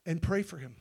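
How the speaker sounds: soft, low reverent retelling; hushed. Acted. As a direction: draw them in softly. Quiet retelling of God's words.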